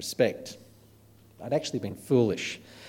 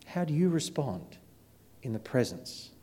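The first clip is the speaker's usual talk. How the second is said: soft, measured appeal with long pauses; solemn preaching; 6 dB quieter than usual. Real, not acted. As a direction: let the closing challenge settle quietly on the listeners. Solemn closing appeal to congregation.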